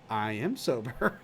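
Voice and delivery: low, soft, slow; deadpan Matt. Retold or imitated. Matt replying to Patrick, low and slow. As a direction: flatly insist. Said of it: "He goes".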